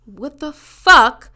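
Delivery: loud, slow disbelief; outraged exclamation. Retold or imitated. Reacting to his new accounts, loud and slow. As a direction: stretch it out, stunned and angry. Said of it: "And I'm just like".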